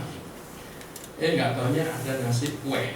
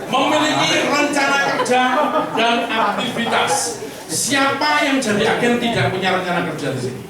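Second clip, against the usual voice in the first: loud, higher preaching to the room; rousing sales pep talk; 6 semitones higher, 7 dB louder than usual. Acted. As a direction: fire up the agents, full force. Loud motivational preaching to agents.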